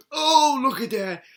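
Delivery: ordinary talk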